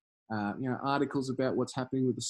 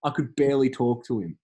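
ordinary talk, nothing marked

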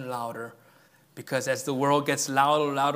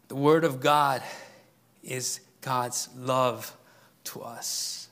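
usual speaking voice

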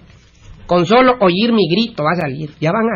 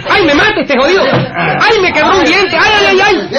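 wailing in pain; 12 semitones higher than usual. Acted. Beaten bully wails to his mother after the punch, hammed-up high howling. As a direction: howl like a beaten braggart, over the top.

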